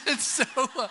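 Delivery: loud high burst of laughter; real laugh. Real, not acted. Preacher laughs at his friend keeping the car, loud laugh.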